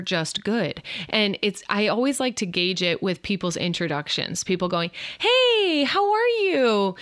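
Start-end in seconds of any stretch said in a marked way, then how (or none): 5.2-7.0 high, bright-sung mock hello; mock singsong hello; cheery greeting. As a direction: chirp it like a cheerful acquaintance.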